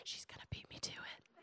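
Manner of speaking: hushed whisper; mock whispered aside. Mother worries her daughter will beat her, whispered. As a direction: whisper it as a private worry.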